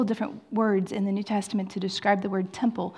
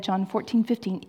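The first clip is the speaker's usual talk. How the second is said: ordinary talk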